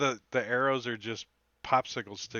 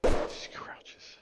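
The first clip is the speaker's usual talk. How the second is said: whisper; 6 dB louder than usual. Acted. Narrating quietly as player.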